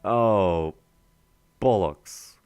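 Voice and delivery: loud sudden swear; annoyed curse. Real, not acted. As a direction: curse the mistake loudly. Breaks field by mistake.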